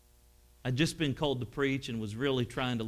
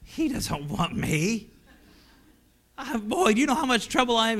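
higher, playful jab at himself; joking tease; 9 semitones higher, 5 dB louder than usual. Retold or imitated. Joking with Brother Jack about his own trouble, playful. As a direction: tease the father figure lightly. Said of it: "I was joking".

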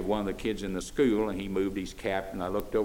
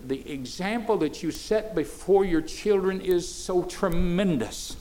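fiery preaching; 7 semitones higher than usual. Acted. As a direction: preach it hard, pleading with daddies and mommies. Preaching at full force.

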